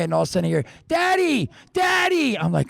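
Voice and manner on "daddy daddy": put-on child calling out. A little girl at bedtime calls her dad back with a high shout. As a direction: shout for daddy across the house.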